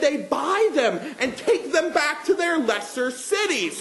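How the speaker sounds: slow, emphatic stage monologue with drawn-out key words; theatrical rhetorical tirade. Acted.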